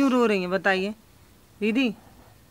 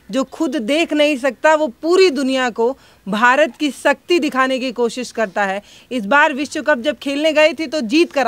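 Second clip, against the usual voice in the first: louder, punchy reporter's piece to camera; news report; 3 semitones higher, 7 dB louder than usual. Acted. Reporter tells viewers about the cricketer's weeping wife, in emphatic news voice.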